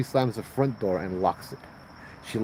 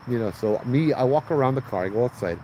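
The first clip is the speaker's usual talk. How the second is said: normal talking voice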